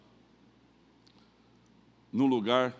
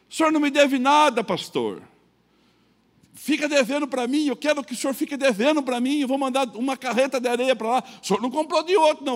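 higher, louder imitated creditor; creditor's reassurance; 11 semitones higher, 6 dB louder than usual. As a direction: voice the creditor insisting kindly.

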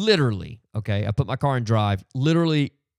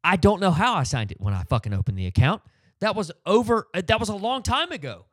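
high, exasperated outburst; losing it; 9 semitones higher than usual. Retold or imitated. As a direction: retell the moment you snapped at the clerk. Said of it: "I lost it".

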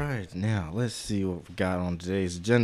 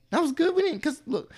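excited high; 4 dB louder than usual. Real, not acted. Excited lunch talk.

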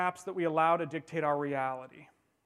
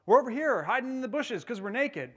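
higher, louder shout from hiding; calling out; 6 semitones higher, 5 dB louder than usual. Acted. Adam answering God from the bushes, loud higher call.

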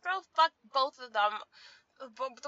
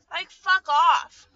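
slow, drawn-out, louder fuck off; fed-up dismissal; 8 dB louder, much slower than usual. Real, not acted. Ranting at people who comment on her head, slow fed-up fuck off. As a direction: spit it out slowly, done with them. Annoyed rant at critics.

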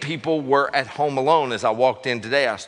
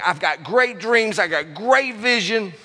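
slow, higher, proud dreams; slow hopeful boast; 8 semitones higher, slower than usual. Pretend. Preacher voicing a hopeful dreamer, slow and proud. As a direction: savor each dream slowly. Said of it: "you go in going".

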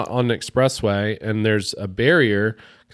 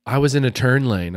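higher, louder mock self-defence; retold indignant protest; 4 semitones higher, 6 dB louder than usual. Angry driver defending herself after a crash, indignant. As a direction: imitate an angry driver insisting on her side.

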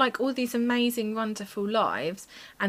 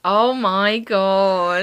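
mock shock; 11 dB louder, much slower than usual. Real, not acted. Reacting to Titanic reveal.

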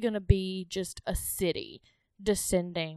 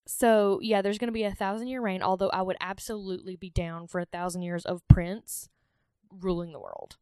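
ordinary talk

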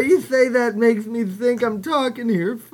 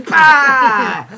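scoffing bah; 2 semitones higher, 9 dB louder than usual. Acted. Grocer scoffing in acted scene.

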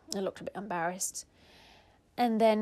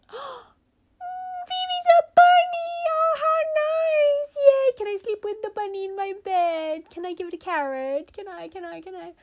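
put-on much higher and louder girlish squeals, fading to pleading; delighted little girl; 8 dB louder than usual. Big sister begging Mommy about the bunny, high delighted squeals. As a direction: bounce with a small girl's joy, then beg.

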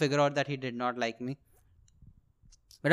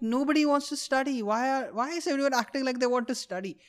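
high, softer mock complaint; exasperated student; 9 semitones higher, 4 dB quieter than usual. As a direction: retort in a small high voice, replaying it. Student answers the complaining classmate.

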